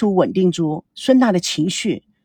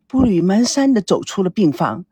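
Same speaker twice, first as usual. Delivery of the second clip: ordinary delivery, nothing marked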